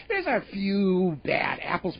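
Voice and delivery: slow, sing-song mock of "There's a few bad apples"; mocking "There's a few bad apples" slowly in a put-on voice; mocking banker. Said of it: "who's basically going on and saying".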